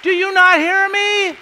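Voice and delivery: shouted, high calls to be heard; God screaming. Acted. Preacher voicing God shouting to the country's leaders, very loud and high. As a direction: bellow it out as God calling to a deaf nation. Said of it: "he's screaming into the lives".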